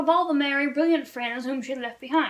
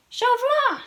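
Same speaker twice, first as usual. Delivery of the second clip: much higher and louder, cries his name in glad surprise; delighted exclamation; 12 semitones higher, 5 dB louder than usual. Acted. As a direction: call out his name with joy. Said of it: "she exclaimed".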